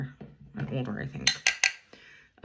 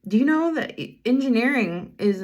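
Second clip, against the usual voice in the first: much higher and louder burst of enthusiasm; jumping with excitement; 7 semitones higher, 6 dB louder than usual. Retold or imitated. Bus driver thrilled that the boy asks about engineering, excited. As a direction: leap at the chance to tell him. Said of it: "like, jumping".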